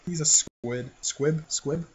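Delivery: normal talking voice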